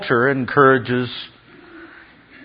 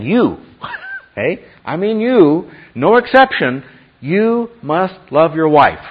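higher, drawn-out insistence on every word; emphatic direct charge; 6 semitones higher, 4 dB louder, slower than usual. Acted. Preacher voices Paul singling out each husband, insistent and emphatic. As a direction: press it on them, slow and emphatic. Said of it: "grabbing the men by the lapel and saying".

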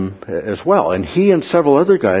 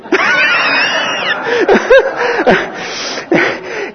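big laugh. Real, not acted. Laughing at his own story.

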